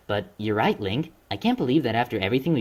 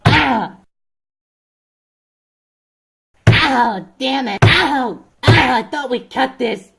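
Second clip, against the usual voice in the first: yelling in pain; 12 semitones higher, 9 dB louder, much slower than usual. Acted. Getting hurt on set.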